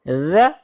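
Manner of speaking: ज़ said with a firm, pressed buzz. Shown as a demonstration. Teacher showing firm letter: firm ज़. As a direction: give ज़ a little hardness. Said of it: "थोड़ी सी सख्ती के साथ अदा होगी".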